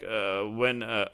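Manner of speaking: ordinary talk, nothing marked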